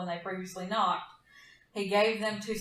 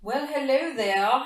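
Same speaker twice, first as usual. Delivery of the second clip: drawn-out mock British hello; British accent; 5 semitones higher, 6 dB louder, slower than usual. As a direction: play it as a hammy posh Brit. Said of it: "terrible British impression".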